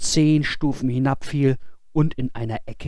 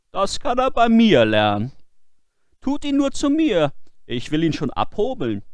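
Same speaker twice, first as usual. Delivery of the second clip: high, loud scheming sexton voice; a crafty sexton, sure of himself; sly sexton; 9 semitones higher, 6 dB louder than usual. Acted.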